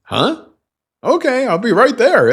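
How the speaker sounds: high, loud startled reply; mock surprised answer. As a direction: play her startled at the door. Voicing her surprised answer.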